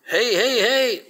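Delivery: high-pitched calling out; mock yell to get attention; high, urgent imitated call. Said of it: "she's yelling at them".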